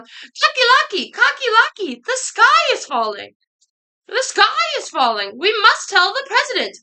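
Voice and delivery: high, loud squawking alarm; panicked yell. A narrator's reading. Three birds to their friend Cocky Locky, frantic yelling. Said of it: "ran to their friend Cocky Locky and yelled".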